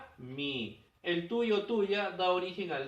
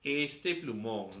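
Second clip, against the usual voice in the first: slow example; 6 semitones lower, much slower than usual. Shown as a demonstration. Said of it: "¿Cómo digo?".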